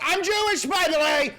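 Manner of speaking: very high, loud mocking outburst; mocking shout. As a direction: blurt it out in a mock shriek. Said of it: "They just randomly throw it".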